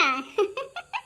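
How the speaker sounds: high coy giggle; a shy little laugh; aunt giggling at the boy. Acted.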